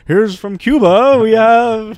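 high, loud, drawn-out announcer call; TV show announcer. Pretend. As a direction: announce the act like a talent show host. Imagined TV talent show introduction.

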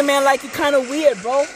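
normal talking voice

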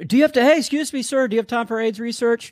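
very high, eager solicitor voice; mock street canvasser. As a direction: accost a passer-by in a high put-on voice. Voicing street canvasser stopping passers-by.

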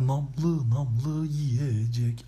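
very slow, stretched-out advert voice; exaggerated slow-motion mock jingle delivery; TV ad slogan. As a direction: draw every syllable way out like a commercial. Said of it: "Almancı reklamından böyle haz alıyordum".

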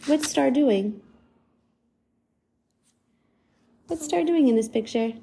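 bright, lilting read-aloud voice; reading to a child. Acted. Parent reading a picture book to a toddler, lilting. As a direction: coax a small child to look.

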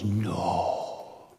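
low, slow, intense whisper; hushed Billy. Retold or imitated. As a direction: grip his face and hush the warning. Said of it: "and he goes".

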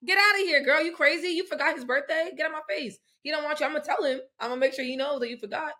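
heated pretend confrontation; mock telling-off. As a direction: tell someone off in pretend outrage. Acting out telling Irina off.

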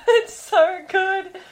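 squeaky, slow laughing; real giggling delight. Real, not acted. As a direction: laugh through the words. Laughing at the scene.

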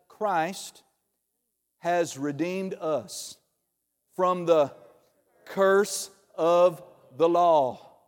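each word drawn out slowly; slow, weighty, every word stressed; slow emphatic quote. Real, not acted. Quotes key verse slowly.

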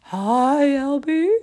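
high, loud hooting character; owl voice. Acted. Owl greeting startled Albie, high loud owl voice. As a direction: hoot the greeting out.

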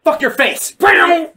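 high, rising fighting shout; hammed-up battle outburst; mock attack yell. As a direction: yell it as you land a blow. Acting out attacker striking.

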